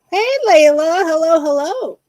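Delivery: high, loud gushing hello; excited greeting. Real, not acted. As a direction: greet her like a long-lost friend. Excited greeting to friend Layla.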